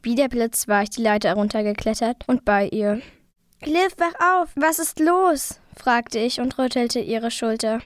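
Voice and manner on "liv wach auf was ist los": higher-pitched, anxious urging; urgent waking call. A narrator's reading. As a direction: call out to rouse your sister. Narrated frantic waking of sister.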